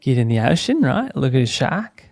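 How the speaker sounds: hammed-up Aussie surfer accent; Australian accent. Host showing off for a friend, hammed-up Australian accent. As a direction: lay on a matey Australian accent, rising at the ends. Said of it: "It's a good Australian accent you got there".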